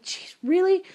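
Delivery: retold jolt of exasperation; exasperated disbelief. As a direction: react as if you can't believe it. Startled by another knock.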